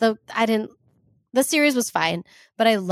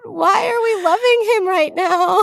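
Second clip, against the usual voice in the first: outcry; 8 semitones higher, 3 dB louder than usual. Real, not acted. Rooting for the Southern villain.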